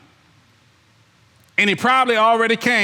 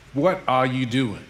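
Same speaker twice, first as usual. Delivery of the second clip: ordinary talk, nothing marked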